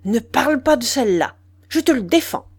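loud, forbidding order; angry command. A narrator's reading. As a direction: forbid it sharply, rising in pitch. Man shaking her, forbidding.

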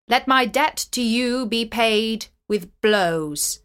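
a touch of royal anger, deliberate at the close; angry king. A narrator's reading. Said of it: "the king was angry and said".

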